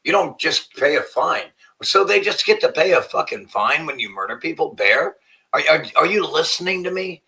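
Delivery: loud furious rant at Bayer. Real, not acted. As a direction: blow up at the screen in outrage. Ranting at Bayer clip.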